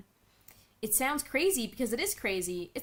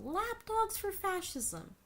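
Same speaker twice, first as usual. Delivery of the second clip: higher, slow mocking echo of lapdogs for fascism; scornful echo of the writer; mocking repeat; 10 semitones higher, 4 dB quieter, much slower than usual. Real, not acted. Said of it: "Do you hear yourself?".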